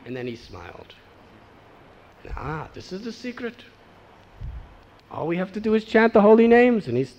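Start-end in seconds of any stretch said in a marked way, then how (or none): 2.4-6.9 delighted discovery. Retold or imitated. Women discover the secret.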